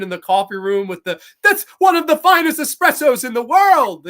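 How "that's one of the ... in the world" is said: high, loud mock proclamation; acted movie line. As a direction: proclaim it loudly like a film character. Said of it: "the people come in".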